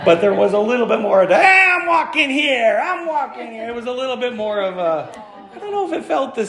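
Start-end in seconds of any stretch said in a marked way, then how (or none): 1.4-3.7 mock Boston accent. Baltimore ballgame crowd snapping at visitors, mock Boston accent. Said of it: "not gonna do a Boston accent impression".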